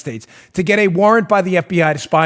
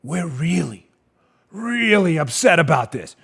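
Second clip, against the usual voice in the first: mock sincerity; 3 semitones lower, 5 dB quieter than usual. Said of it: "I gave you the dreaded wink and the nod".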